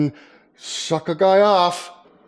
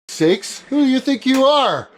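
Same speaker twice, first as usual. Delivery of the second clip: ordinary talk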